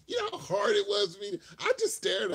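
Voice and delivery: loud incredulous laugh-talk; laughing shout. Real, not acted. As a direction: cry it out between laughs. Laughing exclamation to viewers.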